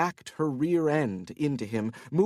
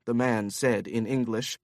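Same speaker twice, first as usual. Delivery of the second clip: ordinary talk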